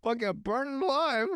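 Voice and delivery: high-pitched comic outrage with laughter; hammed-up squabble in a high voice; mock exasperated rant. Cousin's burnt-oven skit, high mock outrage.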